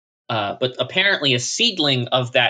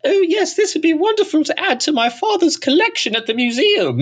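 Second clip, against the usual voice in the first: much higher, posh mock aristocrat voice; snooty British toff; 3 dB louder than usual. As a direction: look down your nose like a snob collecting curiosities. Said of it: "be like".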